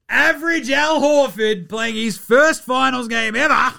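high, loud, punched announcer-style shout; excited hype shout. Real, not acted. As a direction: shout it like a huge headline. Hyping a player's big night.

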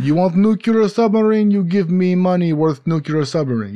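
hammy Russian arms dealer; Russian accent. Pretend. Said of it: "That might have been even worse".

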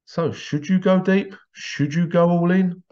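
high, slow, drawn-out questions; slow high-pitched teasing question; dramatic question. Acted. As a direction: tease the listener with a slow rising question.

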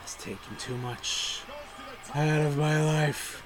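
slow, weary mock lament; exhausted voice. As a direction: drag it out like worn out. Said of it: "It's like".

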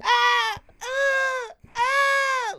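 high, piercing repeated ahs; mock screams of a beaten guy; imitated screaming. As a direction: shriek like you're being kicked hard. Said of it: "I can hear the guy just scream".

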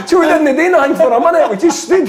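high, loud, helpless laughter through the words; real laughing. Real, not acted. Player telling host about the minute's silence, laughing hard. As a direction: tell it while cracking up, voice pitched right up. Cracking up at own anecdote.

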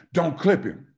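high, loud warning; coach yelling. Retold or imitated. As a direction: bark the warning like a fired-up coach. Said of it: "this guy just screams at you".

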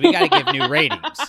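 laughing through the line; giggling out the announcement. Real, not acted. Cohost laughing as she announces new ratings.

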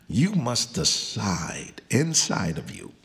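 usual speaking voice

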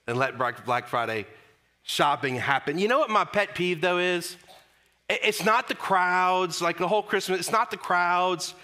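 ordinary talk

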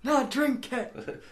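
slow raspy Yorkshire voice; Yorkshire accent, slow and raspy. Acted. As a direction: play a gruff Yorkshireman offering a drink. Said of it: "that was highly offensive".

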